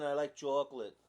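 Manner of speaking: put-on light Long Island accent, "chocolate" as "chawk-lit"; mock Long Island local. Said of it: "I could say it like they say, like, in Long Island".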